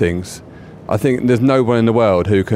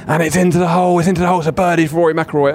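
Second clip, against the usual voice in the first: high, excited commentator's burst; excited golf commentary; 7 semitones higher, faster than usual. Retold or imitated. Golf commentator calling a birdie putt for radio listeners, excited. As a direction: call the holed putt high and quick. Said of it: "the tone in which it went".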